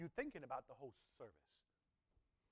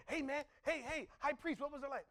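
higher, keen hailing of a friend; eager call; 8 semitones higher than usual. Acted. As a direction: call out, dying to know. Friends eagerly hail the high priest.

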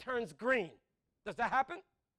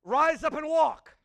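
booming command; 6 semitones higher, 13 dB louder, slower than usual. Acted. Preacher repeating Jesus's command to congregation, slow loud proclamation. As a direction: Rise up and walk, slow and loud with walk falling.

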